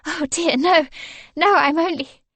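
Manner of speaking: high, hurried and flustered. A narrator's reading. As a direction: rush to reassure him. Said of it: "hurriedly searching".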